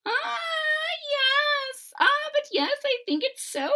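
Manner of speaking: very high squeaky firm insistence; high little man, firmly sure; firm leprechaun. A narrator's reading. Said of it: "answered the leprechaun firmly".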